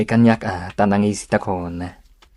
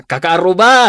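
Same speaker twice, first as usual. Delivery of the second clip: calling out to neighbours; 8 semitones higher, 8 dB louder than usual. Acted. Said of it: "Kasla ka la politiko nga agkamkampanya".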